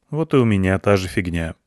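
plain reading voice

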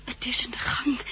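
hushed whisper, afraid to be heard. Acted. Daughter warns of the thing in the hall, whispering. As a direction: breathe the words without voice. Hiding from the triffid in the house.

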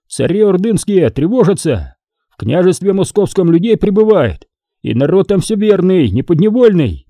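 high-pitched, persuasive yarn-spinner; Foma rousing the crowd. A narrator's reading. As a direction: win the villagers over with big news.

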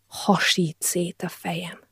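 slow, hushed, aching whisper; pained whisper. Acted. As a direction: whisper it as if your head is splitting. Hungover narrator, splitting headache.

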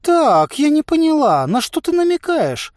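high, loud offended woman; indignant challenge. A narrator's reading. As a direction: demand an explanation, piercingly high. Offended Bombina challenges the merchant.

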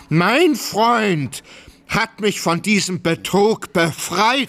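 high, indignant outburst. Acted. Oblomow defending his friend against Tarantjew, indignant.

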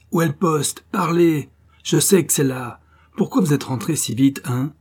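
high, loud shouted questions; furious interrogation. A narrator's reading. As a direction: beat the answer out of them. Sous-officier frappe et interroge. (questions hurlées avec rage)

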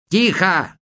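high sudden cry of warning; sharp outcry. Acted. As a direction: cry out to stop the singers. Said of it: "вскричал Мутила". (резкий окрик)

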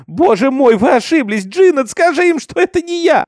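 very high, loud desperate begging; screamed plea. A narrator's reading. Minty begs Jinnet to clear her, frantic scream. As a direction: shriek your innocence, climbing to the top. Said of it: "кричала она".

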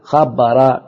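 ordinary talk, nothing marked

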